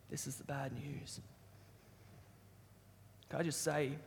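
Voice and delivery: hushed, low and unhurried; grave bad news. Real, not acted. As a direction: let the hard truth sink in softly.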